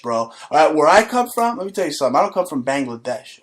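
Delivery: normal talking voice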